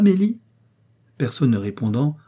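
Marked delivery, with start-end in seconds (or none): 0.0-0.4 name rising high and loud; questioning call. A narrator's reading. Mistress wondering where the maid's coffee is, questioning call.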